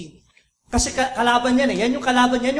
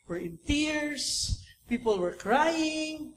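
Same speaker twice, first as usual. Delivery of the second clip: drawn out, softer, each word weighed; slow emphasis; 3 semitones higher, 6 dB quieter, much slower than usual. Real, not acted. Preacher telling the congregation people wept, slow. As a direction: let it land slowly. Slowed emphasis on crying response.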